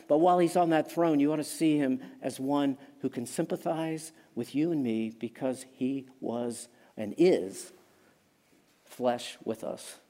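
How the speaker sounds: ordinary delivery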